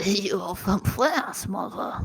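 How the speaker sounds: slow, hammed-up French accent; teasing mother in a fake French accent. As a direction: ham up a French accent as a snooty child. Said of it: "Your French accent is good".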